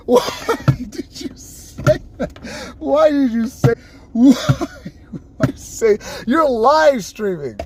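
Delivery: half-whispered, slow, laughing scold; breathless mock scolding through laughter; laughing disbelief. Retold scolding.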